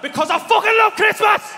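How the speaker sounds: very high, full-volume yell; exploding with festive excitement; ecstatic shout. Acted. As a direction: bellow it slowly in mad joy. Climax of wrapping build-up.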